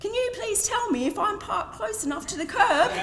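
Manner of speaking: high Cockney voice with a laugh; Cockney accent. Retold or imitated. Pimp in the Merc asking about the curb in Cockney. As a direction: put on a broad London geezer voice.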